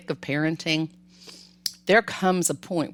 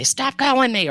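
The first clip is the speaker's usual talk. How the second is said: high, loud fed-up yell; imitating a frazzled mom snapping; mock yell; 9 semitones higher, 5 dB louder than usual. Imagined mother yelling at noisy kids, mock fed-up yell. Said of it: "She didn't yell and say".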